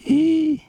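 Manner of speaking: high, sustained squeal of dismay; sharp high-pitched acted cry; shocked cry. Storyteller reacts to the horrible revelation.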